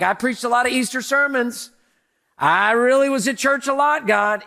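hammed-up pride before heaven; mock boasting preacher. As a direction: puff up like he's proud of his church record. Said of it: "I'm not gonna be like".

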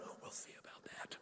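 menacing whispered threat; mock sinister whisper. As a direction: hiss it under breath. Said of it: "said something like".

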